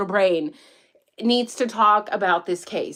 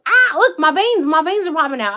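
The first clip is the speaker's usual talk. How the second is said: worked up; 4 semitones higher, 3 dB louder than usual. Real, not acted. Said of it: "I look emotional here".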